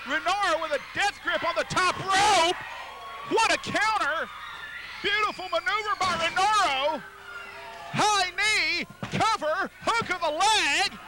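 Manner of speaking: play-by-play. Real, not acted. Live play-by-play at peak.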